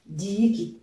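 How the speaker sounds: DIIKI with the first i dragged out; long first vowel. Shown as a demonstration. Teacher pointing out the circumflex in "Diiki", lengthened i. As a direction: linger on the doubled i of "Diiki". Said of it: "l'accent circonflexe".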